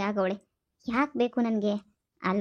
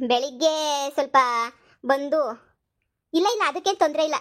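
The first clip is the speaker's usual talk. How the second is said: ordinary delivery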